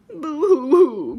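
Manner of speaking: loud, drawn-out pretend boo-hoo; mock crying. Brother piggy left out of the puddle, mock crying. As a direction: wail it slowly and loudly, hammed up. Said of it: "cried brother piggy".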